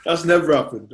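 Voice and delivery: laughing denial; high laughing denial. Real, not acted. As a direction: laugh it off, playfully denying.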